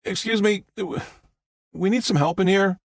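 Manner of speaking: high-pitched call for assistance; calling for help. Acted. Jack asks for help in here, calling out high.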